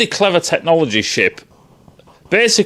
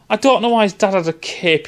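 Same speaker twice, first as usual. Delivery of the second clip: ordinary talk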